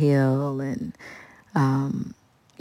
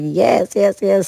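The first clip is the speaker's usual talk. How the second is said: higher, louder eager yeses; 6 semitones higher, 5 dB louder, slower than usual. Retold or imitated. Thrilled singer accepting [name]'s jam invite, higher and louder. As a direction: jump at the offer.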